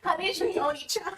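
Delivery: put-on high, breathy anime girl. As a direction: play a cutesy anime girl. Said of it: "[name]'s anime girl voice".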